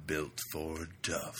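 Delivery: slow, lightly husky truck-ad read; Will Arnett voice. Shown as a demonstration. For his co-host, a slow truck commercial voice. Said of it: "my nice Will Arnett voice going".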